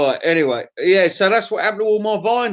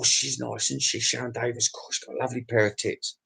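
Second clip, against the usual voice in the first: leering murmur; 5 semitones lower, 10 dB quieter than usual. Real, not acted. Leering aside.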